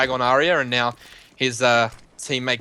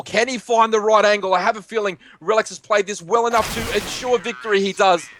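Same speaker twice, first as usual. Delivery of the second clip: raised pitch, building to the win; excited play-by-play; 7 semitones higher than usual. Real, not acted. Caster calls Relics securing the round, excited. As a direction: lift the voice for the clutch moment.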